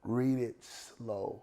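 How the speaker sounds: very slow, deliberate advice; slow, drawn out. Shown as a demonstration. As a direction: stretch out the word "slow". Drawing out advice to read slowly.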